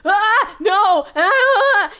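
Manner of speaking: loud, high panicked cries; terrified scream. Acted. Kid seeing the witch at his window, frightened shriek.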